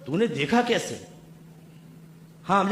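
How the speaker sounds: ordinary delivery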